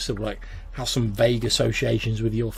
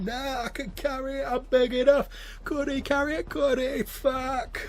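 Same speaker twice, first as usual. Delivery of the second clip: put-on much higher, rowdy drunk telling tales; drunk storyteller. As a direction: bellow an embarrassing story at him. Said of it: "And he was like".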